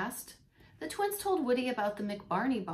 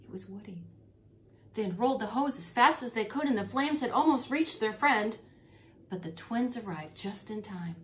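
plain reading voice

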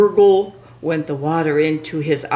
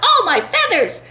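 ordinary delivery

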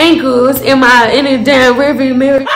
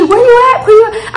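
much higher, urgent calling; frantic friend calling; 9 semitones higher than usual. Retold or imitated. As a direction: call out for her, getting anxious.

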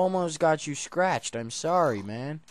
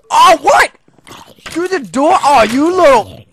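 high loud alarm; startled shout; 11 semitones higher, 15 dB louder than usual. Real, not acted. Mob coming through the door, startled shouting. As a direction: yell in shock.

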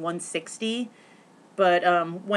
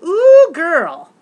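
put-on high, loud sassy girl-friend voice; sassy greeting; 11 semitones higher, 15 dB louder than usual. Retelling greeting Shauna, hammed-up sassy squeal. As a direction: play it like a hammed-up diva hello. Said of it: "I was like".

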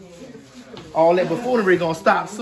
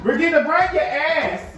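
calling out; 7 semitones higher than usual. Real, not acted. Calling out across the house.

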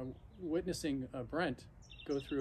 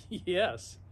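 laughing yes; 4 semitones higher, 5 dB louder than usual. Real, not acted. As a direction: laugh through the word. Laughing at own dedication.

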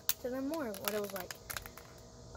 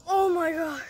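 excited; 6 semitones higher, 7 dB louder, slower than usual. Real, not acted. Catching breath after winning.

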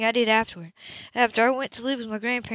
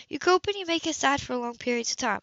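usual speaking voice

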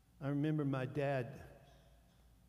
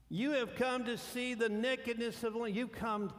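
high accusing voice; 8 semitones higher, 4 dB louder than usual. Acted. Joseph accusing the brothers.

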